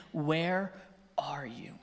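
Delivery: low, slow, near-whispered question; softly searching, barely voiced; hushed calling. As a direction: drop to a hush with space between words. God calling to the hiding man.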